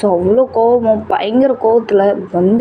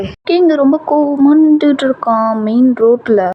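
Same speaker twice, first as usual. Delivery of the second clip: normal talking voice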